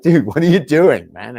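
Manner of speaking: exasperated call-out. Retold or imitated. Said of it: "I'm like".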